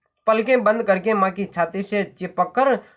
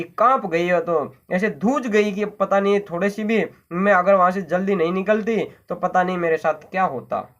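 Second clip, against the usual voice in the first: plain reading voice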